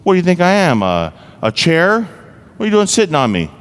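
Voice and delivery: high, playful mock indignation; teasing. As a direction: tease the little girl, pitch up. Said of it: "I said to her kinda teasingly".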